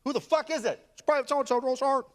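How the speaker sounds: high, brusque military back-and-forth; drill sergeant exchange. Acted. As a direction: call it out like barracks commands. Acted shouted exchange, sergeant and private.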